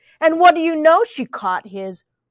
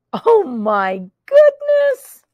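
slow, high oh my goodness; mock amazement; 9 semitones higher, 3 dB louder, slower than usual. Real, not acted. Reading to a child, amazed the pig mooed. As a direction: stretch it out in wonder. Reader reacts to mooing pig.

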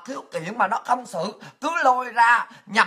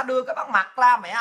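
normal talking voice

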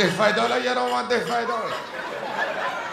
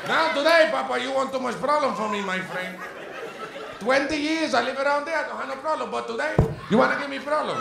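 higher, louder complaint; ranting bodega owner; 5 semitones higher, 4 dB louder than usual. Acted. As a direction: keep scolding the customer.

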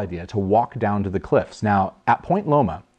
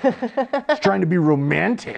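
1 mock sweet voice; 9 semitones higher, slower than usual. Mocking her romantic tone.

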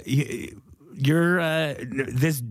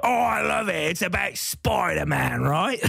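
slow put-on punk heckler; different voice; 4 semitones higher, slower than usual. Heckler chatting about websites, slow put-on voice. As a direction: draw the words out in a character voice. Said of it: "it's a different voice".

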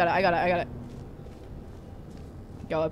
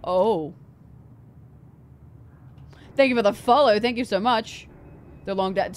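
usual speaking voice

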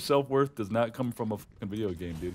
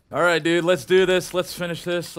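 pumped up; 9 semitones higher, 9 dB louder than usual. Real, not acted. Psyching up for final fight.

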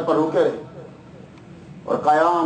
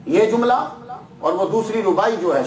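ordinary delivery

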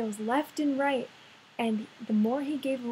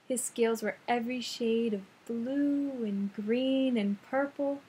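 usual speaking voice

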